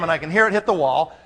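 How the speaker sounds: ordinary talk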